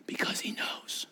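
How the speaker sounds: hushed, breathy secret; whisper. Real, not acted. Preacher whispering to the church, unvoiced hush. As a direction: lean in and whisper it.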